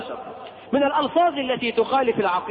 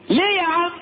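questioning friend; 9 semitones higher than usual. Retold or imitated. Dialogue between two people retold.

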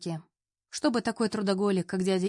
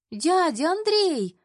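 put-on high drawn-out child's squeal, louder; deafening squeal; 10 semitones higher, 5 dB louder, much slower than usual. Children spotting their uncle Andrey arrive, a long high squeal. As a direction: kids shrieking his name across the lawn. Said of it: "раздаётся оглушительный визг".

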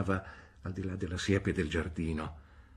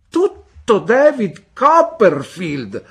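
much higher, very loud, slow cry; shout; 16 dB louder, much slower than usual. A narrator's reading. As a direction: bellow it in scornful outrage. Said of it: "gridò Miss Betsy".

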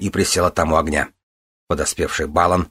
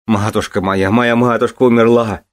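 anguished wail growing higher and louder; despairing cry; 4 semitones higher, 4 dB louder than usual. A narrator's reading. Son lamenting his mother's death, in despair. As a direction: cry out in grief, building. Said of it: "в отчаянии восклицал он".

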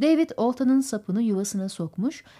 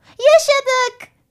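put-on very high, loud child's cheer; jubilant cry; 8 dB louder than usual. A narrator's reading. Andrew rejoices over the swordfish, jubilant. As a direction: cheer in a piping little voice.

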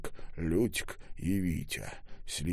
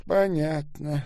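higher, yawned-out word; yawning; 7 semitones higher, 4 dB louder, slower than usual. A narrator's reading. Voice on the intercom losing interest, yawning. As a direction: say it through a yawn. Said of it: "зевнул голос".

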